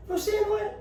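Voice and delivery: high, childlike mocking squeak; distorted mocking voice. Shown as a demonstration. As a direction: parrot it back in a silly high voice. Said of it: "Ou distorce".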